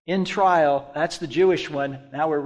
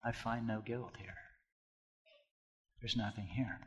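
very soft, low quoted ruling; understated verdict; 6 semitones lower, 18 dB quieter than usual. Retold or imitated. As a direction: give Pilate's ruling low and quiet. Voices Pilate's quiet verdict.

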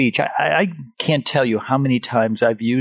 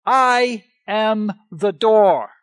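slow, higher, each word weighted in "I am the door"; emphatic quote; 6 semitones higher, 4 dB louder, slower than usual. Retold or imitated. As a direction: declare "I am the door" slowly, leaning on every word. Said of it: "with this emphatic statement".

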